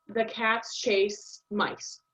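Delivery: slow, each word cats, chase, mice hit evenly; stress on cats chase mice. Shown as a demonstration. Teacher showing students the stress in the sentence, slow and even. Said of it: "that's where the stress is in the sentence".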